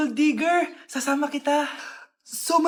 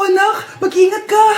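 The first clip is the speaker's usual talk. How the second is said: usual speaking voice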